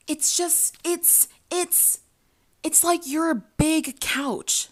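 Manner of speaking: lightly voiced clumsy boyfriend searching for words; imitating the guy. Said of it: "this guy proceeds to say".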